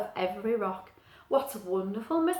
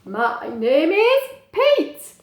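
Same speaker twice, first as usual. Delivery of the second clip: slow, louder, careful penguin voice; penguin Pete; 4 semitones higher, 7 dB louder, much slower than usual. Acted. Pete introducing himself to the bird, slow. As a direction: plod it out like a proud penguin.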